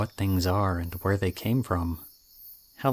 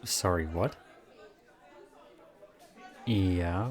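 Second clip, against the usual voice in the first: ordinary delivery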